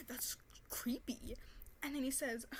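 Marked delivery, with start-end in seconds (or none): 0.1-1.4 whispered slowly, breathy. Retold or imitated. Telling the man it's creepy, whispered slowly. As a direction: hush it out, disgusted.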